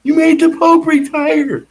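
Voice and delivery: slow, high, loud accusation; cop yelling. Retold or imitated. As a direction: hammer each word at him. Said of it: "He goes".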